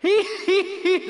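high, loud hee-hee laugh; a last gleeful giggle; dying giggle. Acted. The dragon giggles as he dies, high loud giggle. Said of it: "went the dragon".

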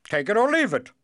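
high, loud royal ultimatum; insistent king. Acted. King gives cobbler a final offer, insistent. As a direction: snap it out loudly, high-pitched.

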